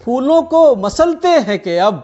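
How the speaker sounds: measured Urdu poetry recitation; tender romantic poetry. Acted. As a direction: recite each couplet with feeling. Poet reciting at mushaira.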